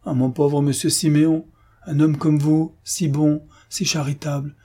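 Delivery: high, plaintive lament; concierge's pitying wail, lightly acted; moaning. A narrator's reading. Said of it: "en gémissant".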